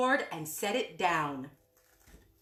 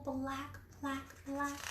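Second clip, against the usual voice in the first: fading repeats; 3 semitones higher, 13 dB quieter, slower than usual. Acted.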